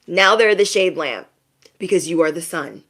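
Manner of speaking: ordinary talk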